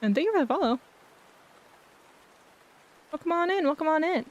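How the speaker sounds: high bright welcoming streamer voice; cute anime streamer. Acted. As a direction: greet the viewers sweetly, up high. Greeting raid viewers in streamer voice.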